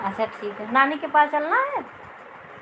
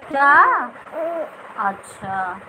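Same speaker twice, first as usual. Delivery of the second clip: normal talking voice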